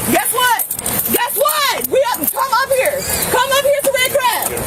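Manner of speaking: high, carrying call to people far off; yelling across the room. Real, not acted. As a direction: shout it across the restaurant. Calling people inside Red Crab.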